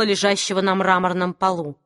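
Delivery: ordinary delivery, nothing marked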